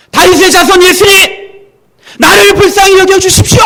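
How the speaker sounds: desperate cry. Retold or imitated. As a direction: cry out to be heard over a crowd. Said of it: "소리를 듣자마자". (절박하게 높이 외치는 소리)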